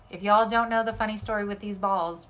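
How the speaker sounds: usual speaking voice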